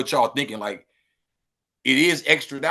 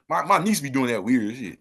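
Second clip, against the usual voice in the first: normal talking voice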